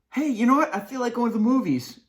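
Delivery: high, loud eager invite; bubbling with a plan; excited invite. Pretend. Friend eagerly pitching a movie to cosine, high and loud.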